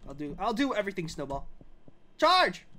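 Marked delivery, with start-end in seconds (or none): 2.2-2.7 high, loud rallying shout; battle cry. Pretend. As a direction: yell it like leading an attack.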